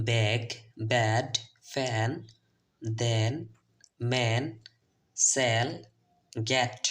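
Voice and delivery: word by word, "ব্যাগ" to "গেট" with a stretched open eh; "ব্যাগ", "ব্যাড", each vowel an open eh, paused between. Shown as a demonstration. Teacher recaps words for learners, "ব্যাগ" to "গেট" distinctly with open eh. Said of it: "প্রথমে আমরা এ নিয়ে আলোচনা করব".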